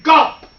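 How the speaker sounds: sudden high, punched gulp; loud gulp. A narrator's reading. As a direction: pop out a big storybook gulp. Swallowing sound effect in story.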